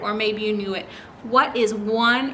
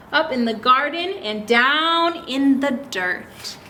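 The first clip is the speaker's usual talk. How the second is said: slow, read-aloud book title; story reading; 3 semitones higher than usual. Acted. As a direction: read it like the opening of a picture book.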